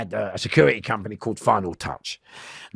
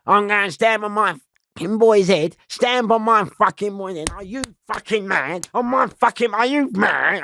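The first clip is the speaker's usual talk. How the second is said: mock fight rant; 9 semitones higher, 3 dB louder than usual. Retelling his fight talk.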